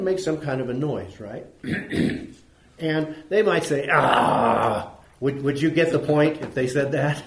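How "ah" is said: long, low dismissive scoff; loud, drawn-out sigh of disbelief. Shown as a demonstration. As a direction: scoff from the back of the audience. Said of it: "somebody's scoffing, what do you expect to hear".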